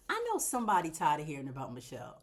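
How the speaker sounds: ordinary talk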